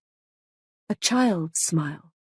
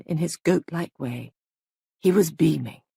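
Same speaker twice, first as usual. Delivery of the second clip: ordinary delivery, nothing marked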